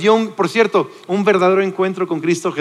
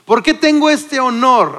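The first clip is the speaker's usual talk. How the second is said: higher and louder, slowed, exclaiming with joy; joyful exclamation; 6 semitones higher, 4 dB louder, slower than usual. Acted. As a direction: cry out blessing with delight. Elizabeth's exclamation retold. (exclamación alegre, más aguda y fuerte)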